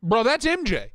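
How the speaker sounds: loud, high, thrilled; excited. Real, not acted. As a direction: shout it out in delight. Excited recognition.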